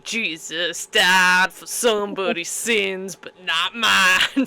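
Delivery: low, slow, soft recitation of a lyric; drawn-out mock-reverent quote.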